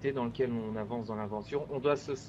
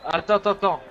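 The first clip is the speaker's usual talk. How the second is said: urgent shout; 6 semitones higher, 9 dB louder, faster than usual. Real, not acted. Urgent call during game.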